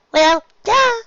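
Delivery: very high, loud scoffing duh; snorting duh. Acted. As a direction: scoff it like it's obvious. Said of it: "Pinkie snorted".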